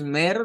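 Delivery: «mer» con la E del español; E española. Shown as a demonstration. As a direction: pronuncia «mer» a la española, con E abierta y clara. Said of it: "con la E del español". («mer» con vocal E castellana)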